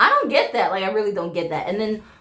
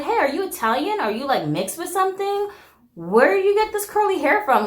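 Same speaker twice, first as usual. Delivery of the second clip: higher-pitched, acted-out nosy questions; lifted, inquisitive approach; friendly curiosity; 8 semitones higher than usual. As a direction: ask a stranger with eager curiosity. Acts out curiously approaching a stranger.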